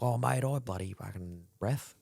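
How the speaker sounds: mock-serious tough bloke, mostly own voice; tough guy mate. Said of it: "he's a real tough guy".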